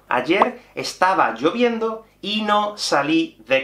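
slow, careful example sentence; slow example read. Shown as a demonstration. Teacher reading an example to students, slow and clear. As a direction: read the example slowly for learners. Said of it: "os voy a poner un ejemplo".

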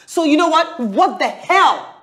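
angry outburst. Acted. Woman fed up with waiting lover, angry outburst. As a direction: blow up and stretch out the curse. Quarrel climax, giving up on him.

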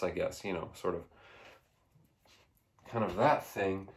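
ordinary talk

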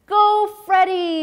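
high, loud, slow cheer. A narrator's reading. Family cheering Freddie on TV, high loud slow cheer. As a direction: cheer him on. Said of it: "they cheered".

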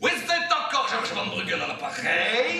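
slow, hammed-up Belgian French; Belgian accent. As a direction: stretch it out as a fake Belgian caller. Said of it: "Je prends l'accent belge?". (accent belge traînant)

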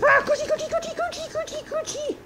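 high cooing coochie coochie; baby talk. Acted. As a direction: coo at the pet. Cooing at guinea pigs.

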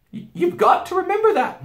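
put-on very high, loud girl; insistent Susan. A narrator's reading. Girl pressing the beaver wife, high and insistent.

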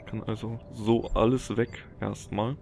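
ordinary talk

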